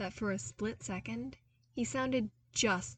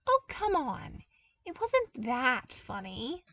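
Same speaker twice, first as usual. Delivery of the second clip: mock complaint; 6 semitones higher, 3 dB louder than usual. A narrator's reading. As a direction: complain but keep smiling. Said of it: "he complained".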